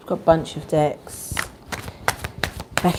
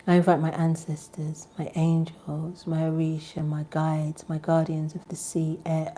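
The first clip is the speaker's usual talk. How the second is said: soft invocation; 7 dB quieter than usual. Acted. Vlogger inviting ancestors into the space, hushed invocation. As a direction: call them in gently, almost whispering.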